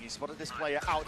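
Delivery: ordinary delivery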